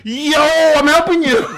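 high, slow shouted yo; mock yell. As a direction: call it out loud and high. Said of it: "He yelled it and was like".

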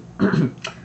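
a nervous clearing of the throat. Acted. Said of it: "cleared his throat nervously".

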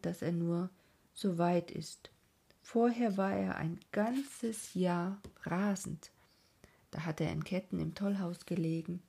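ordinary delivery

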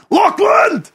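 very high, drawn-out mock yell; losing it. Old man yelling for his son, high acted shout. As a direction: holler across the house, voice straining high. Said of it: "I started losing it".

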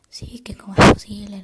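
a sudden loud burst of a retold shout, still breathy; mock yell. Daughter imitating how she used to yell back at dad, a burst of mock shouting. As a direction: blurt it out like the snappy teen she used to be. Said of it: "también hubiera gritado".

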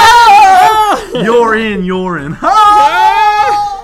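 very high, loud laughing yells; laughing screams. Real, not acted. Host shrieking with laughter at a pun.

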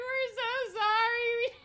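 very high, loud, slow wail; mock wailing apology. Apologising to the sophomores, wailing. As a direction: whine the apology.

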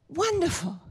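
high, pleased royal exclamation; the king beaming; king's delight. Retold or imitated. King tells Haman his plan is wonderful, high.

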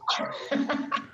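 a burst of real laughter up high. Real, not acted. Co-host laughing at the angry parent story.

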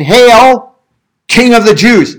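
mocking. A narrator's reading. Soldiers kneeling before Jesus to mock him, mocking hail. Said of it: "and mocked him".

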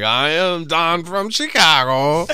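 slow, put-on Russian accent with a laugh; lightly Russian-accented "I am Don from Chicago"; mock Russian call center. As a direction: fake a Russian claiming Chicago. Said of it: "those commercials, he's like".

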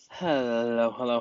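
ordinary talk

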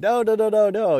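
high, loud rushed no's; loud high-pitched mock panic; frantic reassurance. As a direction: hurry to reassure him he won't be filmed. Reassuring a nervous guest.

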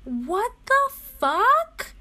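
rising to a high, loud "fuck"; exasperated climb in pitch and volume; outraged outburst. Real, not acted. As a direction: build from confusion to an outburst.

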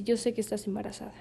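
quick, hushed mock-stern father; severe, grave father. Joking daughter imitates father's accusation, mock severe. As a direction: imitate a grave dad, softly and fast. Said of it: "con voz severa, ¿no? O sea, grave".

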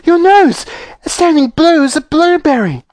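piercingly high motherly panic; horrified scream. Acted. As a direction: shriek in alarm at your child. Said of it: "screamed Missus Beauregard".

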